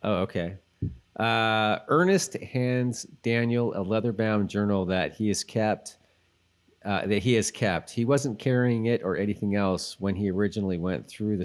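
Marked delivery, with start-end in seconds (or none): none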